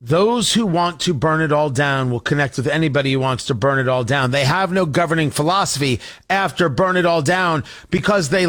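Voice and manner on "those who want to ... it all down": ordinary talk, nothing marked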